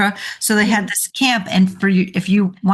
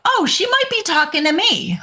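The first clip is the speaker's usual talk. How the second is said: put-on kid noticing; 7 semitones higher than usual. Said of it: "now they're like".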